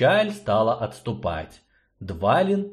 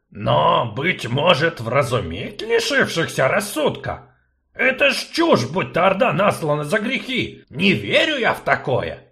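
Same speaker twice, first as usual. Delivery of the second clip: indignant gnome, louder; 7 semitones higher, 4 dB louder than usual. Acted. Gnome argues with sorceress, indignant. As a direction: gnome outraged at nonsense. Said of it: "возмутился гном".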